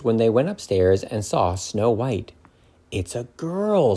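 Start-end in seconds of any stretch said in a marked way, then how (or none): 2.9-4.0 amazed Doc. A narrator's reading. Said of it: "said Doc".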